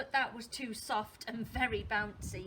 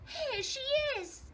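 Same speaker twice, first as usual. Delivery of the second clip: tiny voice as loud as it goes; high, loud little cub's cry; Baby Bear; 5 dB louder than usual. Acted. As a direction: squeal the discovery out at full small-voice volume. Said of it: "tiny little voice as loud as he could".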